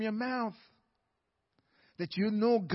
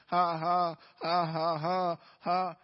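a deep mocking ha ha ha; defiant mock laughter aimed at the devil; 4 semitones lower, slower than usual. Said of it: "That's how he laughed at the devil".

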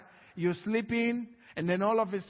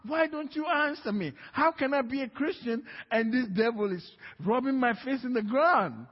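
raised, high-pitched retold plea; crying out to God; 6 semitones higher than usual. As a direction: cry out in frustration, reenacting a desperate prayer. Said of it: "I kept crying out to God".

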